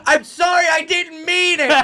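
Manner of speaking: high, loud shouted apology. Retold or imitated. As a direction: shout it back while pedalling away. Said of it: "I'd pedal and be like".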